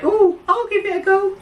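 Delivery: very high woman's voice; lady's voice. Retold or imitated. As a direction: squeak it out like the lady. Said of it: "the lady was like".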